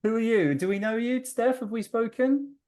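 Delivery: ordinary talk, nothing marked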